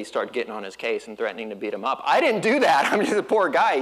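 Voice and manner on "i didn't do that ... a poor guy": loud laughing mock innocence; laughing protest. Real, not acted. Preacher to congregation, laughing mock-innocent protest. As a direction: laugh while playing the innocent victim.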